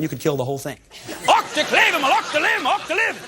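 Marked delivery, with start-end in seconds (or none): 1.3-3.2 loud, high-pitched fake German outburst; hammed-up unromantic German gibberish; mock German. Shown as a demonstration. Host breaking into German to kill the romance, mock German rant. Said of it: "You break into German".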